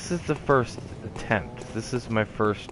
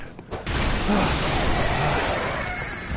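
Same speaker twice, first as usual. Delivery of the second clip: a long, breathless unvoiced sigh; weary sigh; 6 semitones higher than usual. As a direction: sigh like you just climbed too many ladders. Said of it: "A lot of breath".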